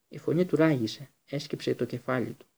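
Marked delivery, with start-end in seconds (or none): none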